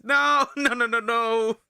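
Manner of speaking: high, loud laughing no; laughing protest. Real, not acted.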